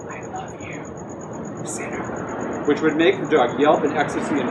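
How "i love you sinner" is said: soft unvoiced whisper. A narrator's reading. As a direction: breathe it in a whisper. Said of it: "by whispering".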